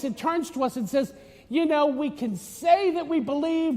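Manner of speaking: ordinary talk, nothing marked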